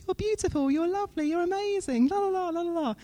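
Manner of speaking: high singsong gushing; sarcastic, lilting sweet-talk trailing into la-las. Acted. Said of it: "sorry, that's a very sarcastic voice".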